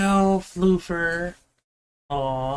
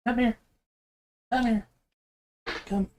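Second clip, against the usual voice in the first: higher sing-song coaxing; coaxing the cat; 6 semitones higher, 4 dB quieter than usual. Real, not acted. Owner coaxing his cat onto his shoulder, high and sweet. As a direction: call the kitty over sweetly, pitched up.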